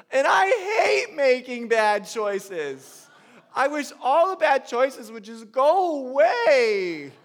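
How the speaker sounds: high, loud, slow sobbing child's voice, imitated; crying little girl. Father imitating his crying daughter in his lap, high tearful wail. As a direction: wail it like a small girl in tears. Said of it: "And through tears".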